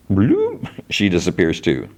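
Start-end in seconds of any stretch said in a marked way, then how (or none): 0.1-0.7 a quick rising whoop sound effect; cartoon vanishing whoop. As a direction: swoop the voice up for a vanishing.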